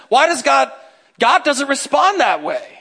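usual speaking voice

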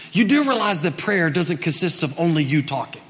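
ordinary talk

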